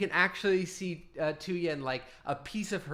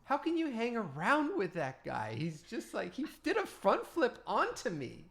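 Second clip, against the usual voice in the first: put-on complaining girl; 7 semitones higher than usual. NPC complains to friends.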